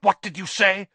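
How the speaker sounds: angry demand. Acted. Malfoy angry.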